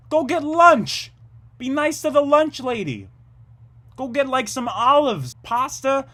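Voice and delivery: loud, high-pitched yelling at strangers; high, full-volume comic tirade; ranting shout. Acted. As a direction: holler at people glued to their phones. Yells at people on their phones.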